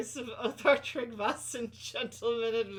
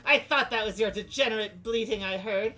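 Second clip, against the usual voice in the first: louder and louder haughty insults; insulting quarrel; 7 dB louder than usual. Acted. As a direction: spit the insults at your rival. Two characters trading insults.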